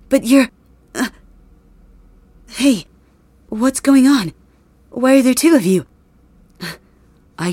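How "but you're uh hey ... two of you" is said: high, loud bewildered questions; stunned by what he sees; shocked confusion. Acted. Shocked seeing two Bakugos.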